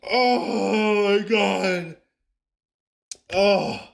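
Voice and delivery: slow, trembling with nerves; shaking from adrenaline; adrenaline shakes. Real, not acted. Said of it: "My hands are shaking".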